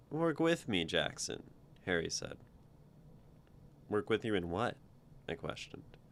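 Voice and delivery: steady read-aloud narration with voiced lines; storyteller voice for a first-person tale; story reading. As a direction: read your story out to the group. Author reads own story aloud.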